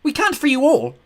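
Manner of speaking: loud, high shout of refusal. A narrator's reading. Girl shouts at the ghost, high and loud. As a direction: yell it back across the room. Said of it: "I shouted".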